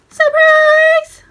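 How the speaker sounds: very high, loud cheerful yell; surprise shout. A narrator's reading. Friends jumping out at Mickey, very high excited shout. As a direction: burst out and shout it.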